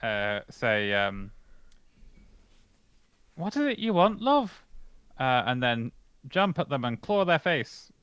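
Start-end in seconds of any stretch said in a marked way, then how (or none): 3.4-4.6 sassy cat voice. Pretend. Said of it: "I say".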